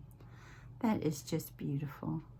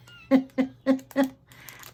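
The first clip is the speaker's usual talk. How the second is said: a big delighted laugh, louder and higher; bright burst of laughter; 8 semitones higher, 8 dB louder than usual. Real, not acted. Crafter laughing at her own old tags, loud and delighted laugh.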